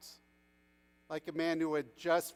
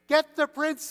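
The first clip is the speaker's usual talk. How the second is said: high, loud call for help; shouting; 10 semitones higher, 9 dB louder than usual. A narrator's reading. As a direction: shout urgently over a crowd. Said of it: "he shouted".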